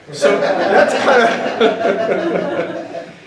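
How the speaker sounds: ordinary talk, nothing marked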